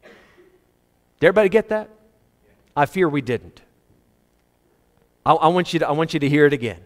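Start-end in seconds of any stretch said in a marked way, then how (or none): none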